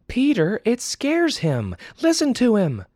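high, loud pleading mother voice; urgent mother. Acted. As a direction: make him listen to the crying baby.

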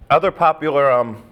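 talking with a mouth full. Real, not acted. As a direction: speak through a mouthful of food. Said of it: "not supposed to talk with my mouth full".

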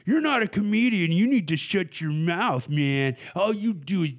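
high-pitched, hammed-up telling-off; mock scolding. Critics telling a comedian off, mock scolding. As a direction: imitate a critic laying into a comedian.

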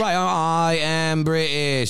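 high, drawn-out mock British; British accent. Insisting to doubters that he is British, exaggerated British accent. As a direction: protest slowly in a put-on British accent. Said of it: "he's saying you're not British".

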